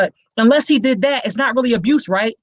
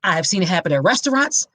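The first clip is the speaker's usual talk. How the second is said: ordinary delivery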